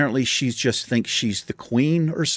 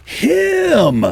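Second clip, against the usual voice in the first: a retold shriek, much higher and louder; imitating her accusing shriek as she points at him; hammed-up furious accusation, shrill and high; horrible voice; 6 dB louder than usual. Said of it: "in the most horrible voice".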